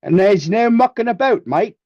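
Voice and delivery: put-on playful British "mate" accent; mock British accent. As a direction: ham up a cheeky British bloke. Joking put-on voice on stream.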